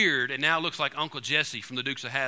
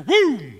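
loud mouth-made gunshot boom; gunshot noise; 7 semitones higher, 10 dB louder than usual. Retold or imitated. Preacher imitating the shotgun blast, loud boom. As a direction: blurt a big boom like the shotgun firing.

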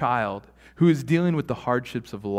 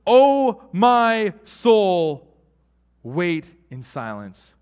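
psalmist's cry; 5 semitones higher, 8 dB louder, much slower than usual. Retold or imitated. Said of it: "he goes".

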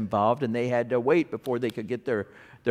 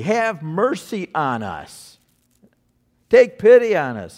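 loud plea; 3 semitones higher, 9 dB louder than usual. Acted. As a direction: cry out for mercy, building to a full-voiced plea. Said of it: "gave this loud plea".

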